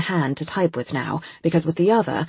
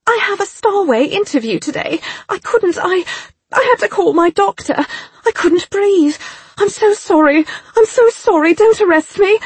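much higher, louder sobbing plea; tearful pleading; 6 dB louder than usual. A narrator's reading. Girl pleading with the agent not to arrest her, tearful. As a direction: beg him not to arrest you.

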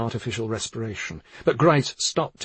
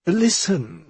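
higher, insistent call for attention; sharper tone; 7 semitones higher than usual. A narrator's reading. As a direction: cut in sharply, aggravated. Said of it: "she took on a sharper tone".

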